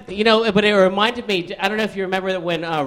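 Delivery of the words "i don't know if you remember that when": ordinary talk, nothing marked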